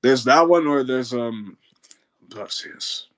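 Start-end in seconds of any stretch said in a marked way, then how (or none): 2.3-3.1 soft, hushed character voice; newer film Perseus. Shown as a demonstration. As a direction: say the name quietly, almost whispered. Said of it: "That's kinda how he sounds in".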